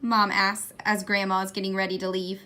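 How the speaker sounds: ordinary delivery, nothing marked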